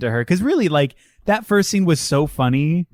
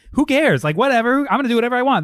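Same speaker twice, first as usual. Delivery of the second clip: higher-pitched devil-may-care quote; carefree defiance; 7 semitones higher, much faster than usual. Retold or imitated. Imitating the woman who doesn't give a shit, breezily defiant. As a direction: toss it off, voicing her carefree attitude. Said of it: "She doesn't give a shit".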